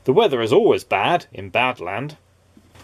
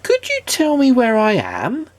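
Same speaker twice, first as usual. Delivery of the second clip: Mister Good, pitched high and unsure; high timid question; 12 semitones higher than usual. A narrator's reading. A lost Mister Good asking the way, high and timid. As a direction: ask it small and lost. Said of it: "he asked".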